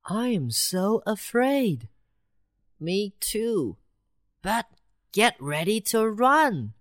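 high, small mouse voice; tiny and nervous, ready to flee; frightened mouse. Acted. Frightened mice whisper.

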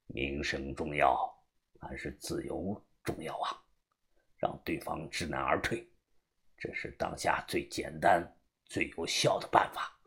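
put-on very low, quiet old man's murmur; scheming old boss. Old man secretly instructing a newcomer to fake madness, low and quiet. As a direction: lay out the scheme low and unhurried.

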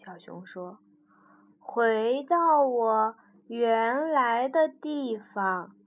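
slow, resolute bear. Acted. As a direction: say it slowly and firmly. Bear says slowly leaving.

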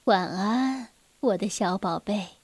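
soft, low goodnight murmur; gently tuck a child in with the voice; tender. A narrator's reading. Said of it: "温柔地说". (温柔低声地道晚安)

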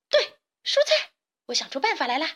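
a squeal of discovery, very high; excited cry. A narrator's reading. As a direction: burst out with the idea. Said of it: "卡门兴奋地叫道". (兴奋地尖叫)

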